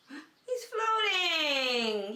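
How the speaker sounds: gasp, then a high, amazed "He's floating!"; delighted gasp. Real, not acted. Teacher gasps as the toy floats, delighted.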